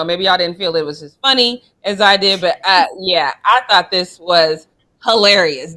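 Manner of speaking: normal talking voice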